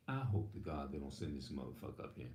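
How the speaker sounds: low, soft muttering to himself; inner thought, hushed. Retold or imitated. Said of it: "In your mind".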